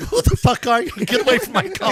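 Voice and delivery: high, very loud yell; mock furious shout. Performer quoting the mix at an intruder, mock shouting.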